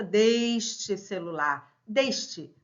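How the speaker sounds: "deste" with a closed E, "DÊS-te". Shown as a demonstration. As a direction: keep the E of "deste" narrow, as ê. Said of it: "Pronúncias diferentes".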